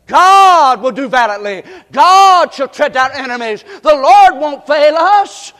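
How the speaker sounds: loud, slow, ringing proclamation; preaching at full force. Acted. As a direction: thunder it out slowly to the congregation. Preacher proclaiming God's victory.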